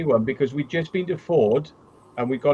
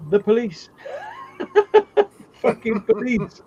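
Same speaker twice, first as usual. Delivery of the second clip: laughing; 10 semitones higher, slower than usual. Real, not acted. Laughing at his own punchline.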